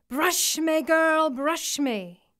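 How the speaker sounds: high, slow, drawn-out plea from the water; a strange head calling from the stream, stretched out slowly; eerie creature voice. A narrator's reading.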